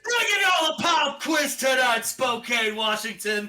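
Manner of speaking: loud, high crowd greeting; arena hype shout. Retold or imitated. Hyping the Spokane crowd, loud arena shout. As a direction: pump up the whole arena.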